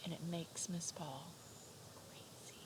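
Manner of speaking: hushed playful whisper. Pretend. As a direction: keep the secret hushed. Whispered secret continues.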